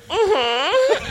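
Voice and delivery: high crying uh-huh, breaking into tears; imitating a friend's sob down the phone; mock crying. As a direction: squeak it out as he starts to cry. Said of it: "And he starts to cry".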